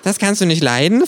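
scheinheilig. A narrator's reading. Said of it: "fragt das Känguru scheinheilig".

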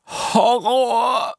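high, shrill-pitched cry of horror; horrified shriek. A narrator's reading. Apollo shrieking at his reflection, horrified. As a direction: shriek at your reflection in disbelief. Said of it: "schrie ich".

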